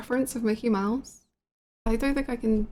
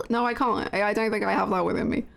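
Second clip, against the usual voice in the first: ordinary talk, nothing marked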